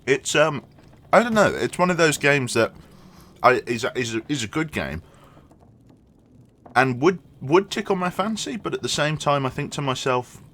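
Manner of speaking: talking between bites; chewing while talking; mouth full. Real, not acted. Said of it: "let me think in between eating".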